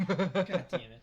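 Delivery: slow, laughing groan. Real, not acted. Laughing at himself.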